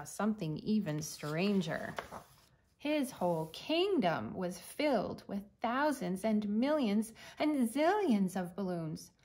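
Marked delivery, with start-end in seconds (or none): none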